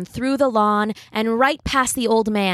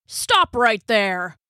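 slow stern cop voice; slow, commanding order; police officer; much slower than usual. A narrator's reading. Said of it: "said the cop".

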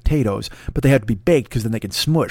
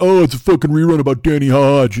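mocking complainer; 2 semitones higher, 6 dB louder than usual. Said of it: "everybody's gonna be like".

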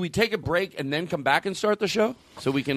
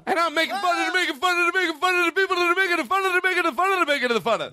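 fast, higher comic babble; fast tongue-twister rant; 13 semitones higher, much faster than usual. Pretend. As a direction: rattle it off fast and high. Escalating making-fun-of bit.

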